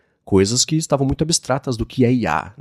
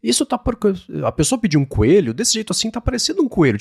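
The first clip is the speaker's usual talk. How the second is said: mock surprise; 8 semitones higher than usual. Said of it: "Em algum momento ele fala".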